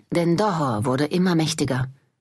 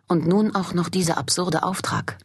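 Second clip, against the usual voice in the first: ordinary delivery, nothing marked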